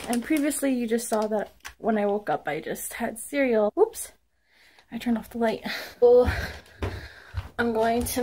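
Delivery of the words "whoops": surprised. Real, not acted. Turned off light by accident.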